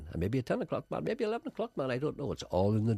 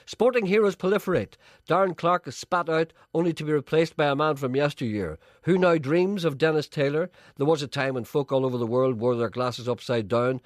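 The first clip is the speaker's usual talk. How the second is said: measured announcer read, pitched up; segment introduction; 4 semitones higher than usual. Acted. Presenter introducing the next comic sketch, announcer read.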